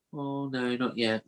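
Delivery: soft, mumbled put-on lad voice; acted half-hearted protest; reluctant lad. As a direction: play a lad quietly putting off the doctor. Voicing lad refusing to exercise.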